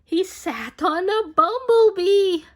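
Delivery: high, loud storyteller's exclamation; excited exclaim. Acted. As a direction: blurt it out like a shock reveal. Story climax, bull sits on bee.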